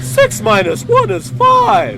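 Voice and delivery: very high, slow, loud mechanical robot chant; shrill-free robot announcing at full volume. Acted. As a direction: sing out the sum like a beeping calculator machine. Robot companion reports a calculation.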